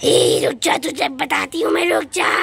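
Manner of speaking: deep menacing witch growl. Acted. Witch chasing the children, deep threatening growl.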